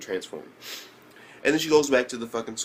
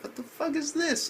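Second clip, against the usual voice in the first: incredulous; 3 dB quieter than usual. Retold or imitated. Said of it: "I'm like".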